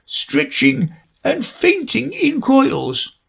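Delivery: very high, slow and tearful; a sobbing old turtle; weepy Mock Turtle voice.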